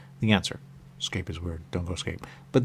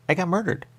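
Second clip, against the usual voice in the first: pitched up high on murdered; shocked exclamation; 10 semitones higher than usual. Real, not acted. Saying it again in disbelief, pitched high.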